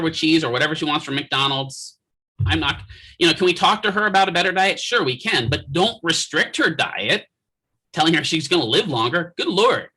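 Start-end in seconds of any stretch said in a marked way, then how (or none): none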